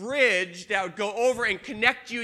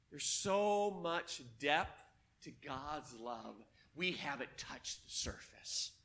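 hushed awe; 9 semitones lower, 13 dB quieter, slower than usual. Acted. Hushed reflection on God's love.

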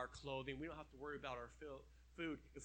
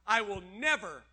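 proclaiming; 3 semitones higher, 14 dB louder than usual. Acted. Scripture promise proclaimed.

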